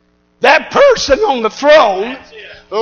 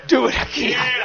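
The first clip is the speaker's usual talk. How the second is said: very soft voice; softly spoken command; hushed divine reply; 10 dB quieter than usual. Acted. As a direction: drop to a hush for God's answer. God's answer to worship, first hushed.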